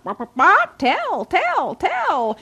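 high clucking chant; clucking hens. Acted. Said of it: "the hens clucked".

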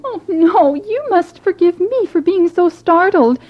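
put-on a shy girl, apologising; young maiden.

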